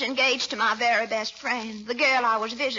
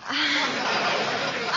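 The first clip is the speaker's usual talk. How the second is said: coquettish laugh. Acted. Leela flirting with Throckmorton.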